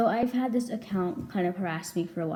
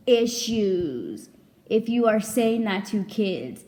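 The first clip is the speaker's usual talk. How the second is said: outraged; 3 semitones higher, 5 dB louder, much slower than usual. Retold or imitated. Outraged retelling of reaction.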